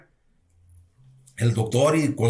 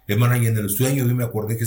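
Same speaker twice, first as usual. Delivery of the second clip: ordinary talk, nothing marked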